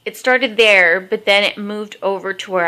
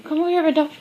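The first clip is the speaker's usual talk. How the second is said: talking to a pet; 8 semitones higher, much faster than usual. Real, not acted. Calling her dog.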